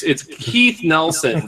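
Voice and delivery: Keith Nelson said slowly and deliberately; slow down and get the name right; drawn-out apology for the name; slow careful correction. Real, not acted. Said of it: "That wine is doing its business".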